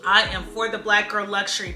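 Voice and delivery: usual speaking voice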